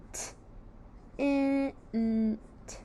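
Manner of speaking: "T, I, N, T" as isolated letter sounds; sounded out. Shown as a demonstration. Teacher sounds out the syllable tint, sound by sound. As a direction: separate each sound in "T, I, N, T".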